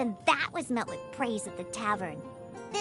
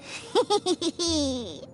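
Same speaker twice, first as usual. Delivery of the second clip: mischievous little cackle; scheming giggle. Acted. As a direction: giggle over a wicked little plan. Plots nasty drink ingredients.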